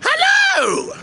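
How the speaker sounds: slow, drawn-out welcome with sudden high notes; theatrical host intro. Acted. Host welcomes viewers to the channel, theatrical intro. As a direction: stretch out a grand show opening.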